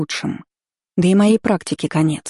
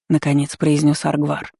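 ordinary delivery

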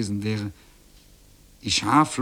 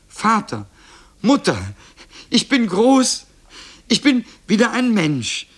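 high, loud, elated shout; exultant high-pitched calling; jubilant boy's cry; 11 semitones higher, 6 dB louder than usual. A narrator's reading. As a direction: cry out your amazed joy to your parents. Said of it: "rief er".

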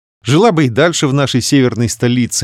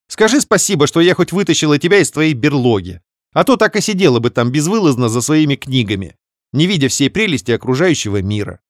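carefree snort; 5 semitones higher than usual. Acted. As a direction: brush it off lightly. Sister teasing. (беспечно фыркнув)

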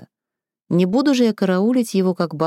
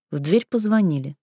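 usual speaking voice

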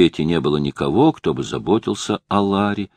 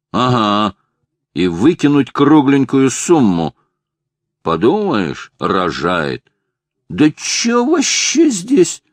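put-on drunk man; 5 semitones higher, 3 dB louder, slower than usual. A narrator's reading. Drunk husband argues with doctor.

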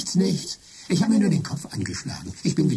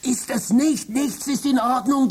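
much higher, desperate protest; frantic; 10 semitones higher than usual. Acted. Trapped Michael shouting to his wife, frantic and high. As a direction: break in, panicked, to warn her.

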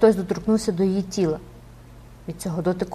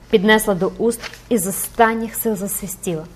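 ordinary delivery

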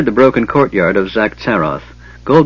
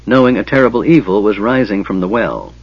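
usual speaking voice

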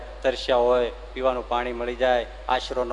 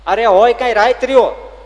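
higher and louder, arguing back; raised voice in a friendly quarrel; heated insistence; 9 semitones higher, 9 dB louder than usual. Acted. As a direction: insist hotly that you did stay. Heated argument with horseman.

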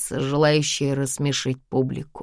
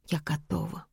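quiet; 10 dB quieter than usual. Acted. Weak consent.

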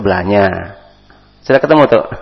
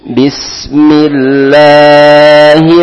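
slow, louder, melodic tilawah; Quran recitation; 7 semitones higher, 8 dB louder, much slower than usual. Shown as a demonstration. Teacher reciting An-Nasyrah to students, slow melodic chant. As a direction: chant each phrase slowly and drawn out.

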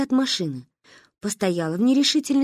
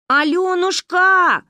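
high, slow, louder call; calling together; 10 semitones higher, 7 dB louder, much slower than usual. A narrator's reading. The girls call the stranger together. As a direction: call out in chorus. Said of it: "позвали мы".

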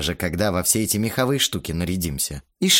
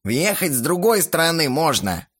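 put-on raised, creaky old watchman; 7 semitones higher than usual. A narrator's reading. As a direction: rasp it out like a gruff caretaker. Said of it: "тот же скрипучий голос".

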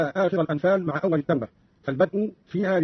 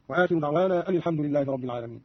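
ordinary talk, nothing marked

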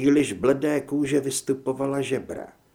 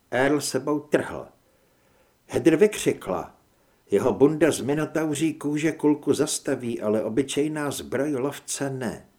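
ordinary delivery, nothing marked

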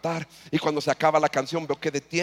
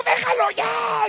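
grito muy agudo y lento; grito de madre; 5 dB louder, much slower than usual. Retold or imitated. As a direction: grita desesperada para que pare. Madre interviene gritando. (madre grita al padre que suelte al hijo)

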